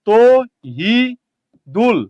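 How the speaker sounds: তহিদুল split slowly into to, hi, dul; very slow three-part তহিদুল with clear gaps; syllable-by-syllable. Shown as a demonstration. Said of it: "তাহলে আপনি কি বলেন?".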